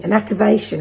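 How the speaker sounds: ordinary talk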